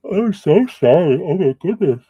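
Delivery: high, loud words stretched by a real yawn; talking through a yawn. Real, not acted. Reader apologises to the client mid-reading, yawning through the words. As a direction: apologise while a yawn takes over your voice. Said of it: "That yawn came out of nowhere".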